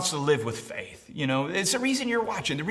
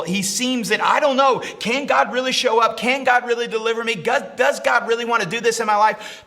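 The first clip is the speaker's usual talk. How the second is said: raised, impassioned sermon voice; full-force preaching; 6 semitones higher, 4 dB louder than usual. Acted. Preacher voicing a man's faith and doubt, full-force preaching. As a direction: preach it out with rising urgency.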